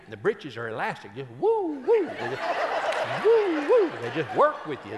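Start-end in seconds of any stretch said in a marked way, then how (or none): 1.4-2.1 high-pitched woo; playful whoop. Retold or imitated. Preacher joking about mama's elastic breeches, playful whooping. 3.2-3.9 very high, loud woo-woo; playful whoop. Retold or imitated. Preacher joking about mama's elastic breeches, playful whooping.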